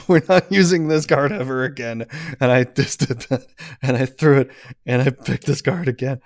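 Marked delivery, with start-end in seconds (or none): 0.1-2.1 retold vow. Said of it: "when I was like".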